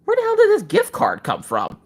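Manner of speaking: mock disbelief; mock bewildered. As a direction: sound truly baffled. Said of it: "I'm like".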